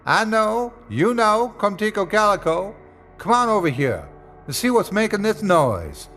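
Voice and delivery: high calling voice; Smitty calling out to his dogs. Acted. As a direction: call the dogs across the yard.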